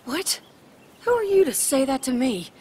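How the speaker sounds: indignant. Acted. Mother told to work on her own issues, indignant. Quarrel after being blamed.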